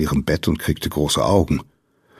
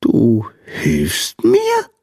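slow, higher-pitched hopeful question; squeaky, slow, pleased surprise; delighted little sandman; 5 semitones higher, much slower than usual. A narrator's reading. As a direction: draw it out in happy disbelief, rising high at the end. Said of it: "fragte der Sandmann beglückt".

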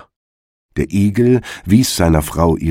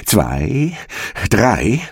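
rising countdown to a race start; storybook starter's count; 3 semitones higher, much slower than usual. A narrator's reading. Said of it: "Der Hase zählte".

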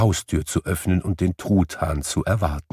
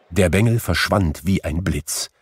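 usual speaking voice

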